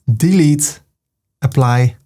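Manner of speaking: ordinary talk, nothing marked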